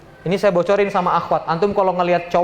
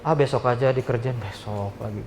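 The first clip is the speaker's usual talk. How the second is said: low, softer, can't-be-bothered mumble; limp and lazy; 8 semitones lower, 6 dB quieter than usual. Retold or imitated. Slack worker postponing the job, low limp mumble. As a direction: sound like a slacker putting work off. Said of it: "Jangan lemesan gitu loh".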